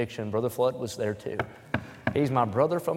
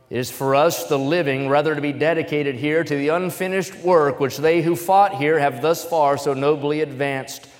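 loud, higher declamation; stirring speech recitation; oratory reading; 3 semitones higher, 6 dB louder than usual. Acted. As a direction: proclaim it like a formal address. Reads Gettysburg Address aloud.

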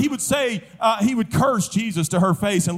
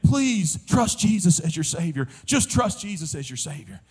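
tender pleading; 6 semitones lower than usual. Retold or imitated. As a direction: imitate a mother gently begging her dying son. Mother pleads with dying son.

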